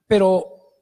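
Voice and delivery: ordinary talk, nothing marked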